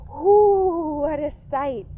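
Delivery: spooky ooh. Acted. Spooky story chant for kids.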